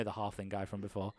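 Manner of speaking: ordinary talk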